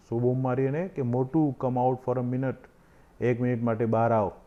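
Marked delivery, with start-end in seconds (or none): none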